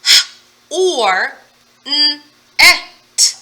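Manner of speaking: "H", "or", "n et" segmented loud; sounds split apart. Shown as a demonstration. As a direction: spell out the sounds for young learners. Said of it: "Now we need sounds".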